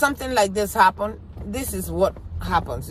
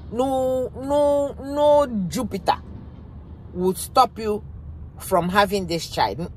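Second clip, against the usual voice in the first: defiant refusal; 3 semitones higher, slower than usual. Retold or imitated. To a man rejecting his pregnant wife, defiant refusal. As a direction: refuse it outright, as if to someone's face.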